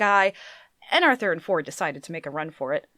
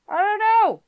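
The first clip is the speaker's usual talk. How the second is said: loud high yell; 9 dB louder than usual. A narrator's reading. Ford yelling back to Arthur amid the chaos, loud. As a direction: shout it over the chaos. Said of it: "yelled Ford".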